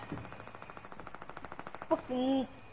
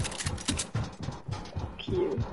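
whispered curse; 10 dB quieter than usual. Retold or imitated.

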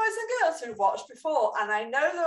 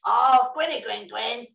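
ordinary delivery

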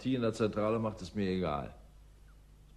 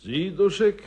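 calling out; 10 semitones higher, 9 dB louder, slower than usual. Acted. Calling out in dark cellar.

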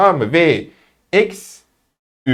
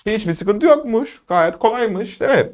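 high-pitched student, lightly softer; mock-naive student voice, pitched up; imitated student; 7 semitones higher, 3 dB quieter than usual. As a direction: play a pupil finding it all easy. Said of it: "diye gidiyorsunuz".

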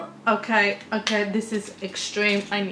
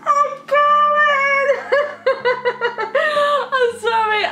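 high, loud, laughing squeals of joy; bursting with happiness, can't hold it in; overjoyed; 12 semitones higher, 5 dB louder, slower than usual. Real, not acted. Overjoyed about getting tour tickets.

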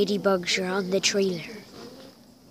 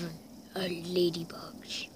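hushed documentary whisper; 5 semitones lower, 12 dB quieter than usual. Pretend. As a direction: whisper it like a nature host. Whispered aside.

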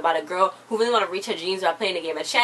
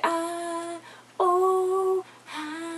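high imitated crowd swoon; mock swooning; 9 semitones higher, 4 dB quieter, much slower than usual. Imitating everybody swooning at the girl band, high mock sighs. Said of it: "Everybody's like".

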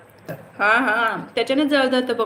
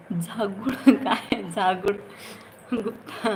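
laughing; slower than usual. Real, not acted. Puzzling over an odd name.

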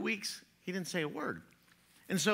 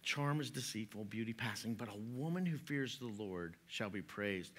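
soft scripture reading; 6 semitones lower, 9 dB quieter than usual. Acted. Quiet scripture recitation.

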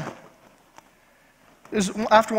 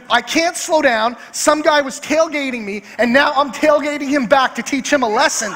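worked-up, higher-pitched retold rant; mock indignant; 4 semitones higher than usual. Wife explaining her tailgating to husband, mock indignant. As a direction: imitate a wife righteously justifying herself.

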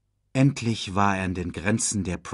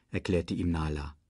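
ordinary delivery

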